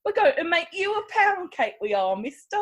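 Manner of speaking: hammed-up British accent, sounds almost German; a bad British accent that comes out German; mock British accent. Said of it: "I forgot to do my British accent".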